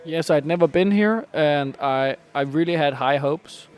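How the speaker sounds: ordinary talk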